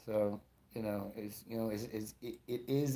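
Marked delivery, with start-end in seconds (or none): none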